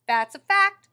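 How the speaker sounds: loud cheerful agreement; hearty blind man. Acted. Blind man agreeing with Nana, loud and hearty. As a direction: boom it out with a grin.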